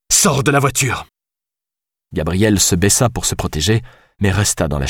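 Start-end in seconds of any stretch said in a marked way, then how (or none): 0.1-1.1 shouting. A narrator's reading. Alice holds him at gunpoint.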